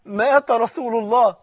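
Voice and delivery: loud, rising sermon lament, each line struck on مات; impassioned preaching. Acted. Preacher crying out the Prophet's death to the mosque, impassioned sermon. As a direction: preach the grief out to the crowd.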